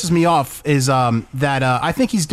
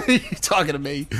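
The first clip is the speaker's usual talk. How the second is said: laughing De Niro quote; 5 dB quieter than usual. Retold or imitated. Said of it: "every reference in every movie".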